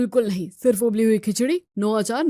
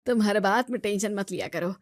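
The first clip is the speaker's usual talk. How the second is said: ordinary talk, nothing marked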